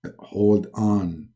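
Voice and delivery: low, soft, unhurried God; calm Allah. Acted. Allah corrects Muhammad's mistake, low and calm. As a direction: correct him calmly in a low register.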